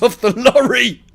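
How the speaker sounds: ordinary delivery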